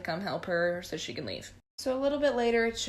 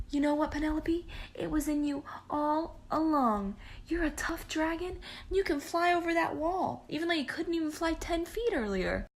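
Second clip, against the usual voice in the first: much higher, gushing encouragement; proud encouraging dad; 7 semitones higher than usual. Retold or imitated. The dad cheers Penelope to fly over the wall, much higher and gushing. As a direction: cheer her on like a proud parent.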